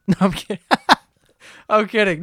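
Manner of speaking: talking through a laugh. Real, not acted. Host joking with listeners, laughing. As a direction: break up laughing while taking it back. Laughing off his own joke.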